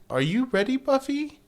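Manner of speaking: high, chirpy mom imitation; sing-song mom calling her daughter; Buffy's mom chirps her question in a high put-on voice; mock mom voice.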